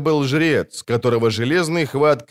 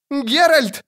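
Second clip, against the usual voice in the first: wailing; 14 semitones higher than usual. A narrator's reading. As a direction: howl for help. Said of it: "вопил Лютик".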